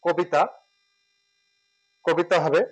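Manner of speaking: কবিতা said as "kobita", first vowel an "o"; kobita pronunciation. Shown as a demonstration. Teacher modelling কবিতা for learners, clear and careful. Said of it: "এটা ওকার হয়ে যাবে".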